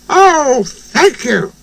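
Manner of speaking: high, delighted little voice; grateful Percy. A narrator's reading. Percy loved the song, high grateful voice. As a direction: exclaim thanks in a high voice. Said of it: "he said".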